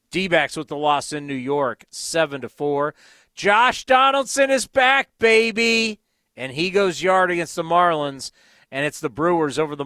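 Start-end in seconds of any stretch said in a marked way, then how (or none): 3.4-6.0 high, loud hype call; cheering. Real, not acted. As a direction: hype up the fans. Cheering a returning player.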